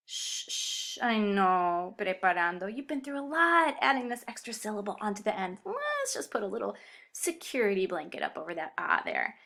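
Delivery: soft, hushing comfort; tender pretend reassurance; mock soothing. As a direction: playfully shush and console, as if to a baby.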